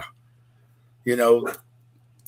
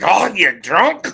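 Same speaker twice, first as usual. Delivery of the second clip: slow, higher Scottish outburst; drawn-out Scottish "drunk", pitched up; Scottish accent; 5 semitones higher, 4 dB louder, slower than usual. Shown as a demonstration. Showing a friend the Scottish version, slow rough Scottish accent. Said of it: "Scottish like".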